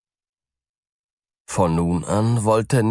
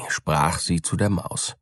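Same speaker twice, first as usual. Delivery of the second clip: ordinary delivery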